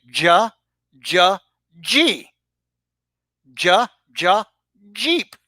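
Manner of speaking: "Ja, ja, G" then "Jeep", slow teacher drilling the J; sounding out G. Shown as a demonstration. Coaching Alpo to say G, slow phonics drill. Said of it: "Follow along with me".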